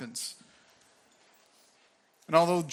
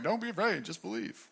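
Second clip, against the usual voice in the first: tossed off lightly, trailing away softer; casual, haphazard; 5 semitones higher, 4 dB quieter than usual. Shown as a demonstration. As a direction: say it offhand, like it barely matters. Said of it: "I don't think he says it casually or haphazardly".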